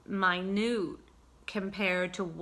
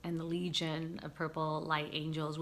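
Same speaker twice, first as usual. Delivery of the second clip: usual speaking voice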